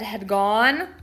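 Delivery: usual speaking voice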